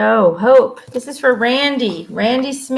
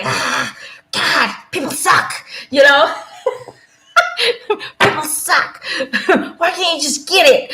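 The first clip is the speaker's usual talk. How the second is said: exasperated; 4 semitones higher than usual. Retold or imitated. Mock frustrated rant.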